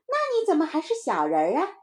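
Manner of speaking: put-on high kid's sceptical question; challenging little child. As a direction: challenge your friend in a child's voice. Child challenges playmate's boast. (小孩高声质疑)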